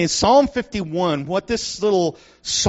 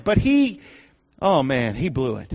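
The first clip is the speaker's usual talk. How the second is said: usual speaking voice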